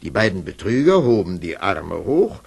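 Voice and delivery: ordinary delivery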